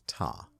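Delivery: "Ta." said short, low and soft, one clipped syllable; curt ta. Shown as a demonstration. Explaining Aussie usage, a low quiet "Ta.". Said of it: "they could just say".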